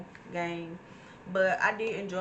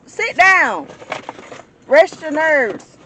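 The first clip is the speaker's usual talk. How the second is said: high, loud, slow scolding shout; furious driver yelling out; angry yell; 9 semitones higher, 15 dB louder, slower than usual. Real, not acted. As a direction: tell them off from behind the wheel.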